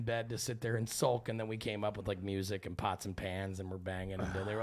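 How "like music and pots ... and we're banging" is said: usual speaking voice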